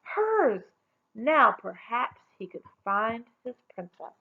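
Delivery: plain reading voice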